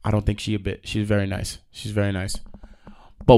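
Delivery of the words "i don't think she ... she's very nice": ordinary delivery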